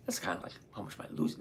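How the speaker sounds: quick, hushed whisper, half to himself; whispered aside. Real, not acted. To his viewers, a quick whispered joke about losing. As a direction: slip the joke in under your breath.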